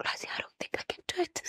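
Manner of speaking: choked, very soft, barely getting words out; real breakdown. Real, not acted. Mother calling Rosie after driving away, breaking down. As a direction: voice gone to a whisper. Breaking down retelling call to sister.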